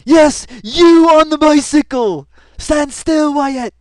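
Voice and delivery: high, loud, slow command yelled after the rider; imitating cop's shout. Cop shouting at a man on a bicycle, high loud command. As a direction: bellow it like a cop stopping a cyclist. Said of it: "He totally calls out to the guy".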